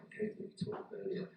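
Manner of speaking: a quick whisper off to the side; hushed backstage note; whispered aside. Real, not acted. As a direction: whisper a reminder to the helper offstage. Aside to helper mid-speech.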